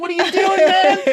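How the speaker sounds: very high, loud retold protest; comically exasperated outburst; indignant protest. Son protests dad volunteering him for a speech, indignant.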